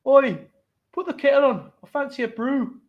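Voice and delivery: high-pitched, hammed-up northern English accent; bad northern accent. Shown as a demonstration. Teacher demanding tea in a bad northern accent. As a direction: do a badly faked northern accent. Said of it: "that's the worst northern accent".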